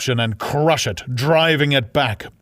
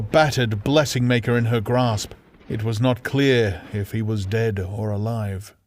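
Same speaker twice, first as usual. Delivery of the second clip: ordinary delivery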